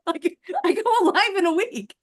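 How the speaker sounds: talking through a laugh; laughing it off nervously. Real, not acted. Laughing at her own panic.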